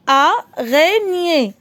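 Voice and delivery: "Araignée" slowly as A, rai, gnée; slow teacher's split of "Araignée"; syllable by syllable. Shown as a demonstration. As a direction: separate the three beats of "Araignée". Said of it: "Trois syllabes".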